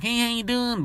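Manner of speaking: very high sugary hello; mocking the salesman's greeting. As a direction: chirp it like a fake salesman. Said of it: "I won't be like".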